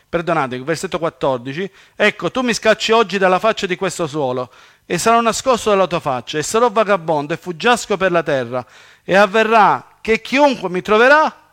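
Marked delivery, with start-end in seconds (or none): 2.0-11.4 declaimed verse, building louder and higher; preacher declaiming Scripture; scripture reading. Acted. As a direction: read the verse out forcefully, rising to the end. Reads scripture aloud with force.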